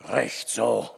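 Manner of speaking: low growled approval; gruff low grunt of satisfaction; grumbling forester. A narrator's reading. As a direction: grumble it deep, as the old forester. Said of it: "brummte der Förster".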